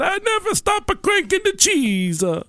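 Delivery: high cartoon Italian voice; jolly italian pizza chef. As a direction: stretch it out, high and silly. Voicing himself, Italian voice.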